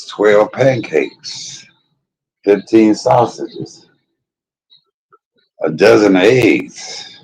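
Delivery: each item drawn out very slowly; slow emphatic list. Acted. Mocking the show's patients, slow listing. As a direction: savour every item of the list.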